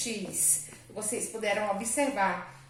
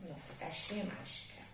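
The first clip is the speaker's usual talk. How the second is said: whispered aside; 4 semitones lower, 13 dB quieter, much faster than usual. Real, not acted. Teacher's aside during dictation.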